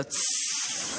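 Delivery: soft unvoiced hiss. San Francisco audience hissing at a trailer, soft hiss. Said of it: "somebody will go".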